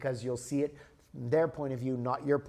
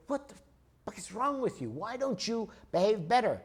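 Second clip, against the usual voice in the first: higher, half-hearted rebuke; mock telling-off; 7 semitones higher than usual. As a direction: pretend to chew him out, not in earnest. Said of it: "If I give him shit and say".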